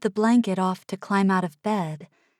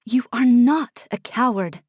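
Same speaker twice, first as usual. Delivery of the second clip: louder sharp rebuke; sharp, louder denial; 4 semitones higher, 6 dB louder than usual. A narrator's reading. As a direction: snap it back firmly. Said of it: "I said sharply".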